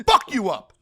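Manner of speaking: loud, punchy mock threat; mock trash talk. Actor trash-talking a basketball star on set, loud mock threat. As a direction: throw it out as playful intimidation.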